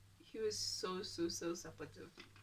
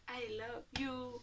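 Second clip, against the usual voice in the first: drawn-out, higher pleading imitation; mock crying; 4 semitones higher, slower than usual. Boyfriend to her at the house, mock crying. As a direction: imitate a man tearfully professing love, slowly. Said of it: "He was crying".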